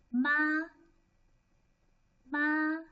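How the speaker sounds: MA held high and level; first tone. Shown as a demonstration. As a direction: say MA on a high, steady, sustained pitch. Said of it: "the first tone".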